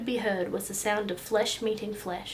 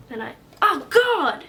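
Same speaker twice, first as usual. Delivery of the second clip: pained cry; 7 semitones higher, 10 dB louder than usual. Acted. Boy crying out as the swat lands, loud and high. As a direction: voice jumps high and loud on God. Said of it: "cried Mickey".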